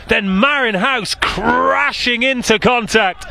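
excited live commentary; play-by-play. Acted.

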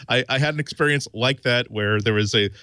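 normal talking voice

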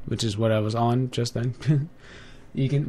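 usual speaking voice

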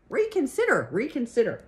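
very high, falling goose cry; called out. A narrator's reading. Goose making a last appeal to Wilbur, high call. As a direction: plead it in a high swooping call. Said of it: "called the goose".

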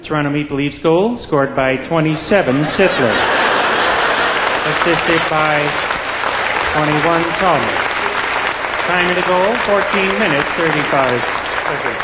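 unexcited, measured rink announcer; low-key arena announcement with long pauses; Paul Morris. Acted. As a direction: read out the goal like it barely matters. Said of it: "Paul Morris was the rink announcer in Toronto".

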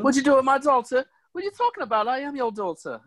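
high-pitched British film parody; hammed-up British dialogue; mock British accent. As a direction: act the scene in a silly high British voice. Acting film scene in British voices.

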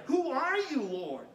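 high, loud call toward the sky; startled cry. Acted. Saul cries out to the voice.

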